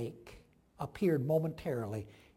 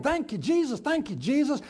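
mock shouting thanks; 6 semitones higher than usual. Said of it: "I just feel like shouting".

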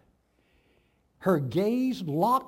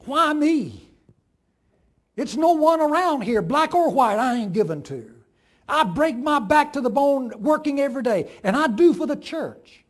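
loud, high outraged complaint; railing at God; 6 semitones higher, 7 dB louder than usual. A narrator's reading. The farm wife rails at God by the hogs, shouting. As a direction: hurl it at the sky.

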